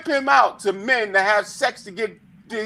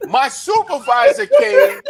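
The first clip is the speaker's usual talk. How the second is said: laughing; 6 semitones higher, 4 dB louder than usual. Real, not acted. Laughing while telling story.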